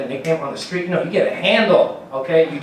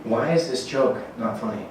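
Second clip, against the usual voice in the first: ordinary talk, nothing marked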